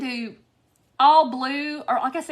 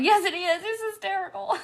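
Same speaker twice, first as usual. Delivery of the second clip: higher-pitched retort, tickled; tickled insistence; 8 semitones higher than usual. Retold or imitated. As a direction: insist on it, pitch climbing toward laughter. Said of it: "I'm like".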